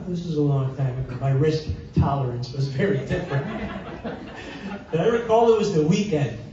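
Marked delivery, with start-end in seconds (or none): 3.5-4.8 breathy little chuckle at himself; amused quiet laugh; soft laugh. Real, not acted. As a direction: laugh it off softly. Laughing at own risky past.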